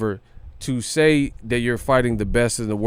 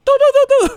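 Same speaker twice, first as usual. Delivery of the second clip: high shrieking laugh; 10 dB louder than usual. Real, not acted. Cracks up at own joke.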